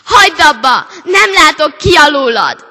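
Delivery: loud high shout. A narrator's reading. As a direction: yell at the hat to stop. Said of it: "kiabálta a lány". (kiabálva)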